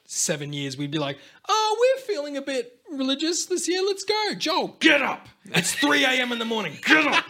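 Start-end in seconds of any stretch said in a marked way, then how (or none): 1.5-7.2 high, loud mock mum calling the family; hammed-up parent rousing the family at dawn. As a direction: shout the kids awake in a hammed-up parent voice. Parent voiced waking family for church.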